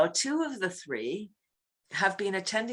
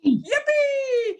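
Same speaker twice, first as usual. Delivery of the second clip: very high, loud squeal; squealing yippee; 6 dB louder than usual. Real, not acted. Teacher echoes the host's cheer, squealing. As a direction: squeal back with joy.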